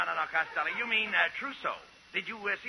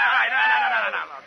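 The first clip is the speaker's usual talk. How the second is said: exasperated shout; 8 dB louder than usual. Acted. [name] shouts him down.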